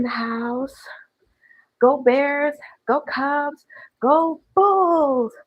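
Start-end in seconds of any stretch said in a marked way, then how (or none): 1.8-5.3 cheering. Real, not acted. Cheering for Chicago teams.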